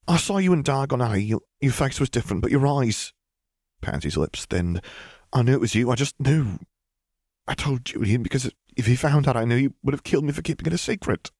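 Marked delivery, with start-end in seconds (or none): 0.1-3.1 high, hurried character voice; rushed, nervous high-pitched recollection; Pansy, nervous young witch. A narrator's reading. Narrator plays frightened Pansy. 5.3-11.3 high, anxious confessing voice; urgent, high-pitched justification; Pansy, nervous young witch. A narrator's reading. Narrator plays frightened Pansy.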